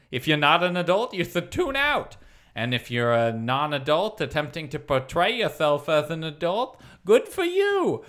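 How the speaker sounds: lightly voiced pompous news host; grand radio announcer, lightly done. Long intro read in Murray's voice.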